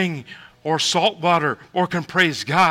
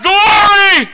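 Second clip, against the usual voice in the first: high, loud shout falling off; shouted Glory; 12 dB louder than usual. Retold or imitated. Freed people shouting praise to God, loud shout. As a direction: cry it out like the freed. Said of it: "And they shout".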